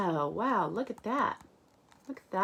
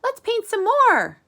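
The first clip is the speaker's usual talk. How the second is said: very high and loud; eager Max; 12 dB louder than usual. Acted. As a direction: burst out eagerly, much higher. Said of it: "says Max".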